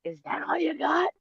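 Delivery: high, mocking falsetto taunt; high mocking put-on voice. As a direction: sneer it up high like a dare. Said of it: "I'm like".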